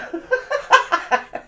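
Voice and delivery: loud high-pitched cackle; high laugh. Real, not acted. The host laughing at herself, a high loud cackle. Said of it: "laughing burst".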